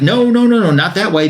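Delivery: mock urgent string of no's, starting high; alarmed objection. Alec stops Swamp Thing heading into town, retold alarmed objection.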